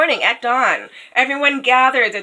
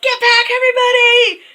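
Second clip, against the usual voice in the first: high, loud shouted warning; urgent shout; 7 dB louder than usual. Retold or imitated. Faithful John shouting the crowd back from the princess. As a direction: yell to clear everyone away. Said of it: "faithful John is like".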